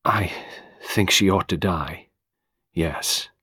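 soft, measured answer with pauses; steady. A narrator's reading. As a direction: say it quietly and deliberately. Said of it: "Carol answered steadily".

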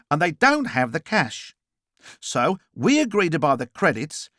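explaining character. Acted. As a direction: explain it high with punched key words. Character's dialogue in narrated story.

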